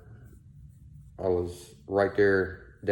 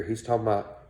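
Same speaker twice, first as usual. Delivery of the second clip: ordinary talk, nothing marked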